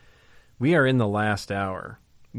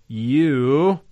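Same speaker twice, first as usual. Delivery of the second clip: "you" called out high and much louder; preacher punching the single word "you"; stressed you; 4 semitones higher, 8 dB louder than usual. Acted. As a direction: hit "you" like a command.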